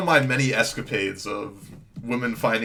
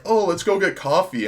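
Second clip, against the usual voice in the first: mock girly voice; 8 semitones higher, 4 dB louder than usual. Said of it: "She's like".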